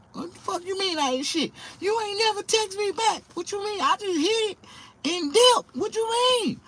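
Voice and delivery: high, sassy woman's voice snapping back; mock an indignant woman telling him off; woman's angry comeback. Comedian voicing an offended woman at Walmart, high and sassy.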